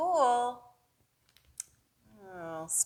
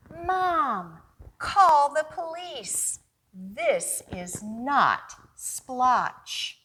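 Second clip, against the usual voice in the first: put-on high, loud child's cry, slowing on the news; a kid sure it was aliens; alarmed boy; 3 semitones higher, 8 dB louder, much slower than usual. Boy character demands police.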